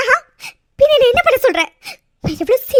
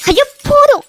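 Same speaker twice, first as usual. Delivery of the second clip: louder, higher cry of enough; exasperated outburst; 6 semitones higher, 4 dB louder than usual. Acted. Bride, fed up with his threats, cries enough. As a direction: stop him, fed up.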